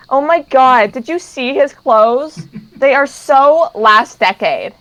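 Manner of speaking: put-on slow, catty Valley Girl sneer. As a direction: drawl it like a snobby Valley Girl. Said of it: "ditzy Valley Girl".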